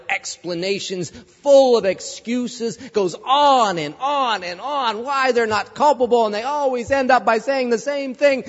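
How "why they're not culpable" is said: normal talking voice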